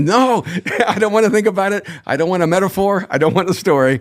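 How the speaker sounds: bursting out at a dad who won't give straight answers; exasperated daughter. Retold or imitated. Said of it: "our daughter, would go".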